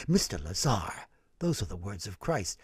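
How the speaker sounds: mock priest. Stanley mimicking the priest, low and soft. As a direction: imitate the priest's gentle rebuke. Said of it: "Stanley quoting the priest".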